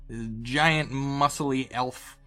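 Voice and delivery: normal talking voice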